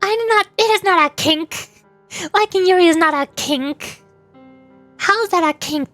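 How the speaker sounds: high-pitched, defensive protest; indignant denial. Real, not acted. Streamer defending Yuri to chat, indignant.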